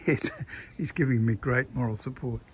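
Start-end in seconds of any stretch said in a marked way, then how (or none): none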